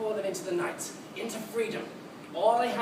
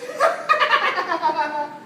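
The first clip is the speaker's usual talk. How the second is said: loud high cackle; wild villainous cackle; maniacal laugh; 7 semitones higher, 6 dB louder than usual. Acted. Madman laughs at war's cause.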